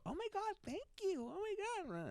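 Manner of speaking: hammed-up girlfriend, high and breathy thanks. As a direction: squeal it like a charmed girlfriend. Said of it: "my girl".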